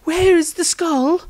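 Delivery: a priestess finding her voice again; shaken woman. Acted. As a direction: Rosandria after the carnage, high and anxious. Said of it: "regain her voice and asked".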